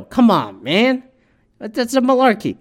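lightly voiced Biden catchphrase; Uncle Joe impression. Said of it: "This guy sounded like Uncle Joe".

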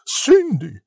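high, loud call; fairy's cry. A narrator's reading. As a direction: call out her name from on high. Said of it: "she cried".

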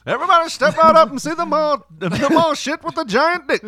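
high, loud barker call; carnival barker. Pretend. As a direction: hype up the crowd like a showman. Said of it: "carnival act somewhere".

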